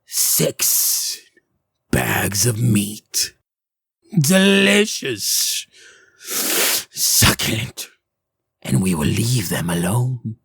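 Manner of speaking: slow, savouring witch voice, half-whispered; hungry hag. Acted. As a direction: relish each word like a meal. Hag craving the villagers.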